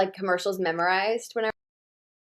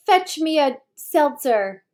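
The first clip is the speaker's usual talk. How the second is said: high, loud, drawn-out mock command to a servant; mock imperious order; 8 semitones higher, 8 dB louder, much slower than usual. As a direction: play at ordering the maid about, loud and slow. Imagined order to a maid.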